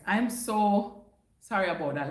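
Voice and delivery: ordinary delivery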